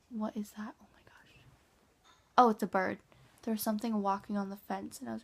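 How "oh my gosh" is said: whispering. Real, not acted. Startled by something on fence.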